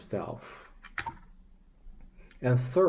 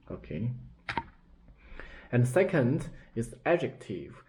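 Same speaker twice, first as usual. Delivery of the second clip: normal talking voice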